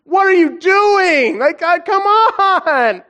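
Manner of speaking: high, loud, slow cry of disbelief; anguished plea to God. Retold or imitated. Father recalling his sick son's relapse, crying out high and loud. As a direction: wail it at the heavens, half crying. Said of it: "I was like".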